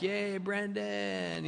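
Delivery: mock cheer. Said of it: "they're all like".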